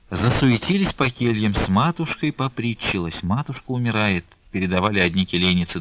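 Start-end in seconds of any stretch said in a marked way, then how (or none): none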